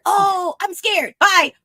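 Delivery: high, hammed-up fright; mock scared. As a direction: squeak it and flee. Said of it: "he's like".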